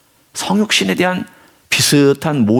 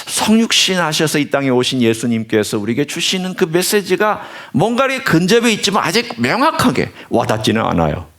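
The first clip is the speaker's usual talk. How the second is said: ordinary talk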